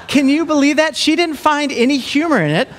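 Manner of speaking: high, amused disbelief through a laugh. Real, not acted. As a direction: ask it while still laughing. Laughing at the story.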